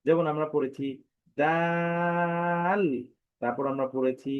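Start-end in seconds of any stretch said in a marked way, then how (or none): 1.4-2.8 "দাল" with the vowel held very long; long-drawn letter name. Shown as a demonstration. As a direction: recite "দাল" on one sustained, chant-like note. Teacher recites letter name, drawn out.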